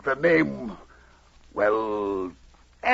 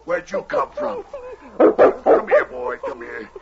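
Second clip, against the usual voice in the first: surprised; 14 semitones higher, 4 dB louder than usual. Acted. Dog finds wounded man.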